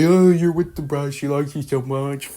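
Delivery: high, loud whiny wail, imitated; mock crying Frankenstein. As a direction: whine it like a mock tearful complaint. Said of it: "Frankenstein starts crying. He's like".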